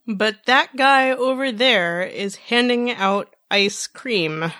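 put-on slow, deliberate drunken enunciation; slow, careful drunk. Drunk Bo points out ice cream, slow careful speech. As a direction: take it word by word, avoiding slurs. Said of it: "Bo spoke very slowly, making sure not to slur".

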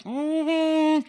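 high, drawn-out skeptical hum; mock the idea with a knowing hum; imitated sarcastic mm-hmm. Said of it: "And they're like".

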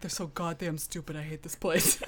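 put-on light Northeastern accent, grumbling about neighbors; Northeastern landlord grumbling. Landlord complaining about snooty neighbors in a Northeastern accent. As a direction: mutter a griping Northeastern complaint. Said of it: "he had this deep voice".

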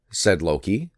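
usual speaking voice

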